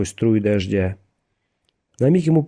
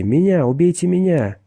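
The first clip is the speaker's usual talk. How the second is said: high, raised pleading cry; desperate cry; 9 semitones higher, 3 dB louder than usual. A narrator's reading. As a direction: cry out high, begging to be taken instead. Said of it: "снова закричал Гэрроти". (отчаянный высокий крик)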